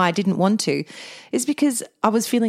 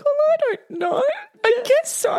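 much higher, mock-feeble and sorry for herself; pitiful reply. Answering husband whether she'll be okay, much higher mock-pitiful. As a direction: play the brave little victim. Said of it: "I'm like".

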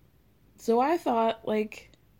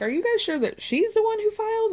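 mock incredulous question; 11 semitones higher, faster than usual. Garcelle quoted asking.

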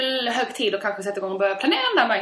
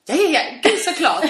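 high and louder, tumbling yeses; eager excited yes; 7 semitones higher, 5 dB louder than usual. Retold or imitated. Wife eagerly tells husband of course there's a present, higher and louder. Her eager reply in story.

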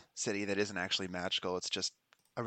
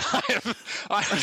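high, loud words through helpless laughter; laughing hard; 5 dB louder than usual. Real, not acted. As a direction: crack up while trying to speak. Cracking up over the roll.

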